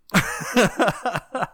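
sudden high burst of laughter; loud high laugh. Real, not acted. Laughs at own joke.

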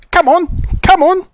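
very high, eager calling; delighted shouting. Acted. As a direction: urge them along in a high excited squeal. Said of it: "shouting in delight".

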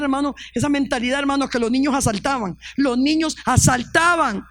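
normal talking voice

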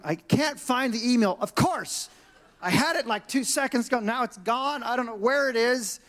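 high-pitched flustered complaining; exasperated panic. Retold or imitated. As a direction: get worked up over the lost email. Retold panicked search for lost email.

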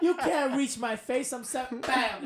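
high, loud, cocky mock boast; acted ring taunt. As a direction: taunt the opponent loudly, drawing it out. Acts out in-ring taunt, kick.

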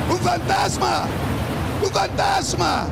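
screamed high in panic; terrified cry. Acted. Disciples in the boat scream in terror. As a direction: shriek it like frightened disciples.

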